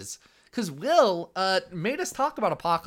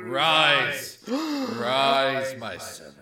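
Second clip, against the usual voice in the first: commanding vampire, sinking low; Dracula voice; 3 semitones lower, much slower than usual. Acted. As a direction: raise your new servant. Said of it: "I, Dracula".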